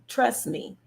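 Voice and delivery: normal talking voice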